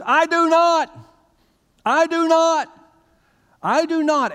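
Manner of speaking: high, loud shouting of a desperate first grader; childish shout. Retold or imitated. First grader shouting back at taunting classmates, loud and high. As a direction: yell it with hands over your ears. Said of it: "I started shouting at the top of my lungs".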